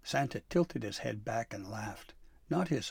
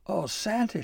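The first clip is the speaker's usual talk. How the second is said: high, loud distressed cry; 10 semitones higher, 5 dB louder than usual. A narrator's reading. Dabbledy alerting Santa to the mess, high cry. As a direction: call out in dismay. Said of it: "she cried".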